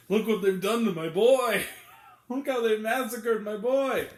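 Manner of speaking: mock-tragic cry over a ruined film series; mock anguished lament. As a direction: wail it like a wounded father, half joking. Quoting a dramatic film lament.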